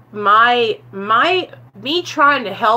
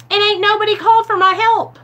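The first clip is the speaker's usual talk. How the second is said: sassy; 10 semitones higher, 4 dB louder, faster than usual. Real, not acted. Sassy self-mockery.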